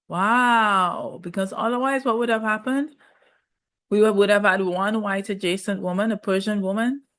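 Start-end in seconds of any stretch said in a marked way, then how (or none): none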